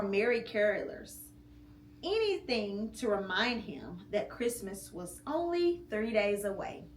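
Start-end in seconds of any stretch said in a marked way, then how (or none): none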